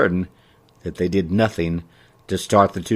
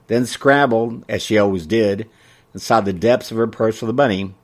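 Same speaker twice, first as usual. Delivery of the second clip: usual speaking voice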